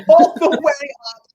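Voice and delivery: laughing up high and loud. Real, not acted. Telling customers about use, laughing loudly. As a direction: giggle through it, pitch jumping way up. Laughing while describing lift.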